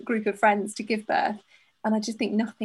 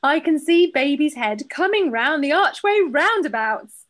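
slow, loud, higher midwife announcement; declaring midwife; 4 semitones higher, 5 dB louder, slower than usual. Acted. Host reading out the midwife's remark, loud declaration. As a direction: declare it like a midwife, slowly and loudly. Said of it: "declared in her thick Irish brogue".

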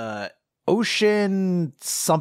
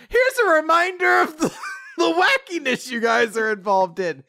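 hammed-up mother voice; mock announcement; 12 semitones higher than usual. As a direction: announce it like a cheesy reminder. Mocking passerby mother line.

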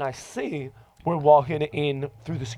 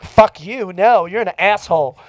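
loud, raised-pitch mock insult; retold angry retort; 4 semitones higher, 7 dB louder than usual. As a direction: imitate someone firing back an insult. Woman insults him back, retold.

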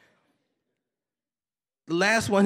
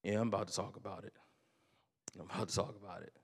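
much softer, lower, holding back tears; tearful quiet; 7 semitones lower, 14 dB quieter, much faster than usual. Real, not acted. Pastor admits to the congregation he's crying, quiet and tearful. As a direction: choke back tears and speak under your breath. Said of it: "I'm crying".

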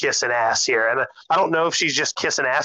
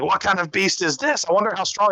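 higher, eager wonder at a beast; eager wild boy; 6 semitones higher than usual. Retold or imitated. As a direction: size up the train like a rival. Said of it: "Inosuke is like".

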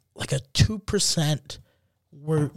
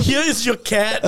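higher mock German officer; comic German-accented English; 4 semitones higher than usual. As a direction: present it in a put-on German accent. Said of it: "your German accent".

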